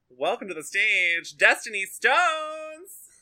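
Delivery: long high showbiz announce; diva announcement. Acted. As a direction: welcome the diva to the stage.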